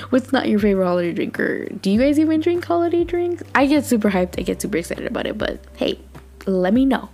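usual speaking voice

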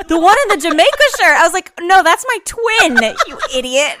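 high, loud incredulous cry; shocked outburst. Retold or imitated. Retelling the mover's mistake, high loud incredulous cry. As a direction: she can't believe what she's hearing. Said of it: "And I was like".

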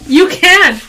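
emphatic loud insistence. Real, not acted. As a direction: shout it back, insisting. Sisters arguing about bullying.

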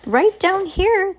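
high, slow call from down below; echoey voice from below. Acted. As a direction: stretch it out like a voice echoing up a ladder. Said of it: "the echoey voice said".

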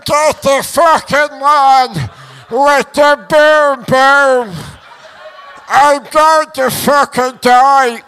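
loud, drawn-out hammed-up cries for help; winded panic. Winded boxer begging for help from the canvas, mock-panicked wail. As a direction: wail slowly like a man sure he's dying. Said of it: "And I'm going to him".

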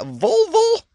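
sudden high squeal of disbelief; incredulous squeak. Pretend. Host squeaking the odd word back in mock disbelief.

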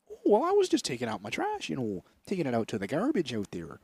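sing-song Wisconsin accent, playing innocent; Milwaukee accent. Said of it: "I have to do the accent".

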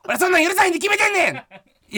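high shouted Kansai scolding; Kosei impression. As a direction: yell it like an angry senior comedian. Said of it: "昴生さんのモノマネ".

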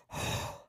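acted winded puff; out-of-breath exhale. Nurse reenacting losing her breath mid-report, winded exhale. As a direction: blow out air as if out of breath. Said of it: "I'm out of breath".

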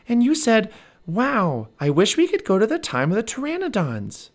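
put-on high excited girl's voice; little girl Annie. Annie calls to Jack, high excited little girl. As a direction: Annie calling out, bright and high.

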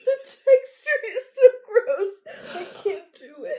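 high, loud, helpless laughter through the words; laughing to tears. Real, not acted. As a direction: laugh until you cry. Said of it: "You're crying".

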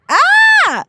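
very high acted yelp; startled shriek. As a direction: shriek as if suddenly lifted off the ground. Acted shriek when picked up.

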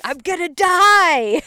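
high, loud, drawn-out mock wail; mock panic yell. Friend voices the rider facing the trees, hammed-up panic yell. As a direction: yell it slowly like doom is coming.

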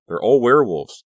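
high, loud cry of alarm; horrified realization. A narrator's reading. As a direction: let the horror burst out.

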